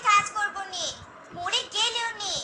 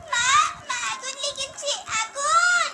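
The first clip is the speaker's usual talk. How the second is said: high, loud frantic shouting; a mother screaming for her daughters to escape the fire; panicked cries of fire; 4 semitones higher, 3 dB louder than usual. Acted. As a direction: scream for help as the house burns. House on fire, calling out.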